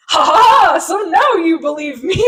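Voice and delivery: high, loud mock gloat with a cackle; hammed-up smug official laughing; gloating villain laugh. Retelling the official gloating, loud and high mock laugh. Mocking the big government guy.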